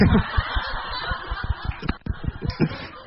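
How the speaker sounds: breathless silent laughter; unvoiced laugh. Real, not acted. Monk laughs at his own punchline, breathless. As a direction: laugh soundlessly at your own joke.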